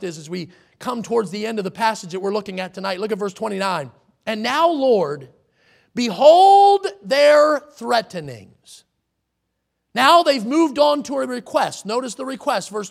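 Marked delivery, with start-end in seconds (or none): 4.2-8.8 slow and much louder, each word weighted; booming, drawn-out King James reading; dramatic scripture reading. Acted. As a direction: proclaim the verse from the pulpit. Preacher reads scripture aloud.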